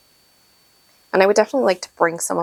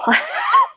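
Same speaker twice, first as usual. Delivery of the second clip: a high, choked little laugh; teary high laugh. Real, not acted. Sharing adoption news, a high teary laugh. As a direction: laugh to keep from crying.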